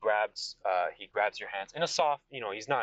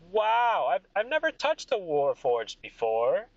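high, loud wow, then slower; awestruck king; 9 semitones higher, 5 dB louder, slower than usual. Acted. King touching a Warforged's metal, awestruck. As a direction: marvel as the king, pitched up. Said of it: "And he goes".

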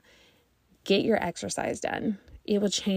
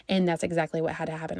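ordinary talk, nothing marked

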